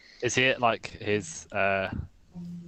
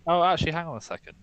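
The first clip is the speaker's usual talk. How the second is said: higher-pitched feigned afterthought; 9 semitones higher, 3 dB louder than usual. Acted. Player turning back to the shopkeeper, feigned afterthought. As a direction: toss it out as if just remembering. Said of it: "sort of turn to go and I go".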